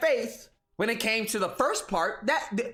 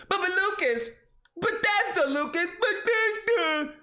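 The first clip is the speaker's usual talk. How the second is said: higher, dopey whining fanboy; mock dim console fanboy voice; 9 semitones higher than usual. Xbox fanboy mocking in a dopey fanboy voice. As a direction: play a dim fanboy taunting. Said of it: "your native tongue of Tard".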